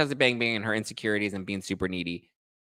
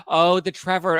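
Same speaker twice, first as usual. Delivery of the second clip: gasp of surprise; 9 semitones higher, 5 dB louder than usual. Real, not acted. Host suddenly recalling the Trevor drama, gasping high.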